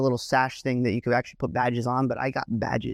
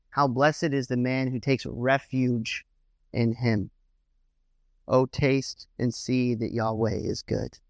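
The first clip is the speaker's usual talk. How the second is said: scripture reading; slower than usual. Acted. Preacher reads the psalm to his congregation, slow reverent reading. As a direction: read the verse slowly and reverently.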